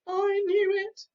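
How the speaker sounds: slow, high, loud wail. Acted. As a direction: wail hopelessly. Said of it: "wailed Cowslip".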